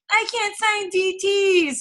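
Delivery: high, drawn-out mock whine; whiny complainer. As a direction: whine it like a helpless complainer, slowly. Mimics someone complaining to Pam.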